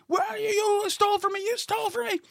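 high, soft imitation of angry shouting; mock distant yelling. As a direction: mimic a furious man calling from far off. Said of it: "I can hear this guy yelling".

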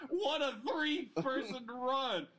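laughing through the words; cracking up mid-sentence. Real, not acted. Laughing at the pig's feet.

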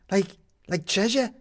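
high, eager stammered question; excited, stammering treasure hunter; eager captain. A narrator's reading. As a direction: stumble over it with greed.

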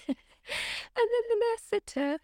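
high drawn-out buildup. Retold or imitated. Building to punchline.

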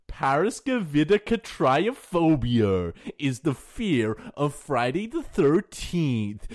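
hammed-up countdown narrator with swooping pitch; Watch Mojo. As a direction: parody a list-video host, big rises and drops. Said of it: "Do you like my Watch Mojo voice?".

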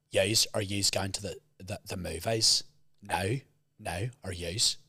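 Northern Irish accent. Shown as a demonstration. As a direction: demonstrate a Northern Irish accent, low-key. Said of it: "The North are like".

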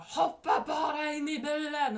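loud, high imitation of a man screaming; retold angry scream. Man following her home screams at her to get in, loud high yell. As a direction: yell it as an impatient order. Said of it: "då skriker han".

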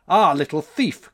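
loud accusing cry. A narrator's reading. January confronts the boy who stole, crying out. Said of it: "cried January".

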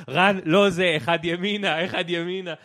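loud, insistent correction; yelling directions. Retold or imitated. Directing Ran to the right popsicle, loud. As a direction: shout at him to move one over. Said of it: "ואני צועק עליו".

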